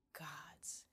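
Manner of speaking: whispered in stunned realization; hushed, voiceless exclamation; soft oath. A narrator's reading. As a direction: breathe it out under the breath. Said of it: "he swore softly".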